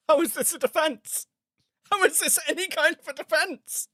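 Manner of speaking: can't believe what he's hearing; incredulous. Real, not acted. Incredulous at the defense.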